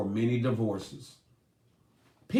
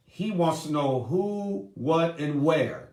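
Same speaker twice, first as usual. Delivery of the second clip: ordinary talk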